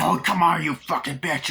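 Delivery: hammed-up aggressive shout; angry taunt. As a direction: goad an opponent in a fight. Acts out fight.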